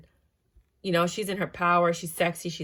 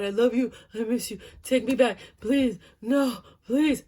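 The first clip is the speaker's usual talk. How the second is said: mock pleading ex; 5 semitones higher, 4 dB louder than usual. Ex begging to be taken back.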